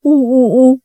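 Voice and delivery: high, lightly voiced boo-hoo; sobbing. A narrator's reading. Daughter sobs to her mother, a light mock whimper. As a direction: sob like a spoilt girl. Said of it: "哭道".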